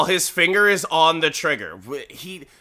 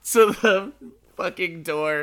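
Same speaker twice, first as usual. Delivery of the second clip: ordinary talk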